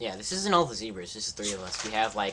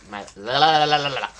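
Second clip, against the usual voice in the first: lisp demo; 3 semitones higher, 8 dB louder than usual. Pretend. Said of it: "my lisp by now".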